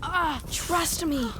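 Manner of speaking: ordinary delivery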